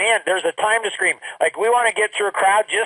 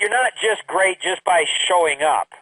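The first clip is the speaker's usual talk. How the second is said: usual speaking voice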